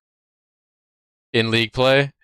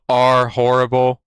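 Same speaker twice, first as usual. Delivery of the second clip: deadpan insult; slower than usual. Retold or imitated. Acting out typed insult, word by word.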